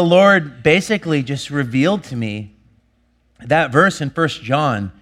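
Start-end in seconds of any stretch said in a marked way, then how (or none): none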